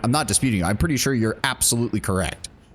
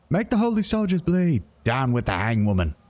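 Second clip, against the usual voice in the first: a mock rebel cry, starting high; rally the gang against the soldiers; defiant gang cry; 7 semitones higher than usual. Gang member yells back.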